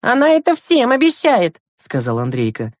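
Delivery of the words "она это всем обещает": put-on very high, louder child voice; high-pitched kid chiming in; young boy Andreyka, matter-of-fact. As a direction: pipe up like a little brother.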